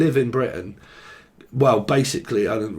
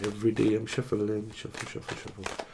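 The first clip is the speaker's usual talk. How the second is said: very soft, fading to a whisper; murmuring while shuffling; 9 dB quieter than usual. Real, not acted. Tarot reader to self while shuffling cards, hushed murmur. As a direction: let it trail off into whispered repeats.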